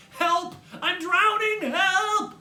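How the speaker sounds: high, loud cries for help; panicked screaming. A narrator's reading. Boy trapped in flooding shower cries out, panicked screaming. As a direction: scream for rescue.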